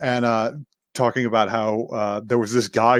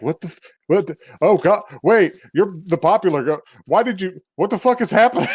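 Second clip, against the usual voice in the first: stammering, higher-pitched mock shock; bewildered outburst; 7 semitones higher than usual. Teller voices boy stunned by popular girl's crash, bewildered outburst. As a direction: sputter in rising disbelief as a baffled classmate. Startled boy reacts to head-smash.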